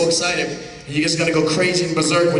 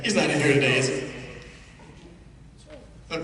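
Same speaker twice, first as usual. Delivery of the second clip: normal talking voice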